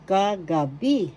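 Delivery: Kagabi drawn out, final "bi" stressed; stress on third syllable. Shown as a demonstration. Teacher demonstrating where the accent is, stress on "bi" in Kagabi. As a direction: separate the syllables of Kagabi and punch the last, "bi". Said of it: "the third syllable is where the accent is".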